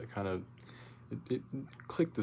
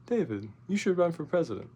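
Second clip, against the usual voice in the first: friend's suggestion; 8 semitones higher, 5 dB louder than usual. Retold or imitated. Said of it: "turns to me and says".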